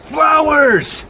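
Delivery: put-on flowers shouted for the kids; big voice. Shown as a demonstration. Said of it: "big voice!".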